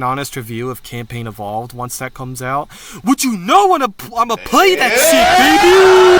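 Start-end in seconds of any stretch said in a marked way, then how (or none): 3.0-6.2 high shouted hype, voice cracking. Real, not acted. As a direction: yell it up high until the voice cracks. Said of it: "That, that voice crack was, uh, really fucking bad".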